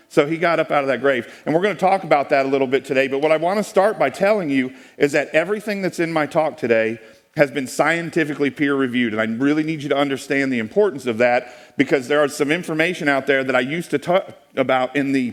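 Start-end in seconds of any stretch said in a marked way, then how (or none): none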